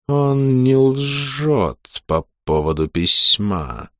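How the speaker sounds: slow eerie hissing mage; strange whistling voice. Acted. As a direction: draw out each word, sinister and sibilant. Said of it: "странным свистящим голосом".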